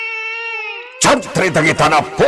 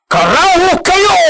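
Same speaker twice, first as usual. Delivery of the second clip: high-pitched furious yell; furious curse. Acted. Master cursing them all, furious yell.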